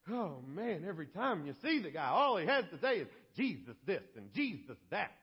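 hammed-up exasperated griping; grumbling guard. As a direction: grumble about a tiresome prisoner. Acted guard grumbling about Paul.

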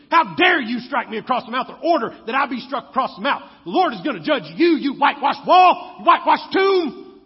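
loud, fast, furious outburst, pitch climbing; indignant yelling at the high priest; angry rebuke. Retold or imitated. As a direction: shout him down as a whitewashed wall. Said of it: "And he goes".

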